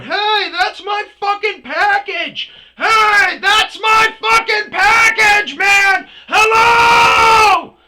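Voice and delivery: full-volume high-pitched holler, words stretched; furious yelling. Retold or imitated. Man yelling at driver from the shoulder, furious holler. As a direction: bellow it across the road.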